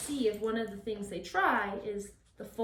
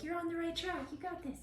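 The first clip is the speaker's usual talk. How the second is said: higher soft coaxing; encouragement; 8 semitones higher, 5 dB quieter than usual. Shown as a demonstration. Trainer encouraging puppy on the right path, soft coaxing. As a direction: nudge him gently on. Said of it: "give them a little encouragement".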